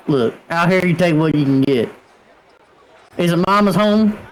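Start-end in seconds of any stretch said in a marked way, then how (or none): none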